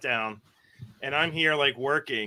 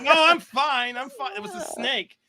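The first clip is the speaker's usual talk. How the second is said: embarrassed; 12 semitones higher than usual. Retold or imitated.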